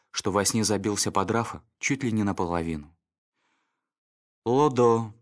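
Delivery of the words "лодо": ordinary delivery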